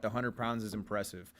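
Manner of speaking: ordinary talk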